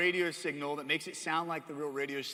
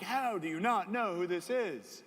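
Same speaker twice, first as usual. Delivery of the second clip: slow, higher mock disbelief; drawn-out imitation of an appalled elder; shocked; 4 semitones higher than usual. Pastor stunned you don't know this musician, shocked. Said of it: "He was just shocked".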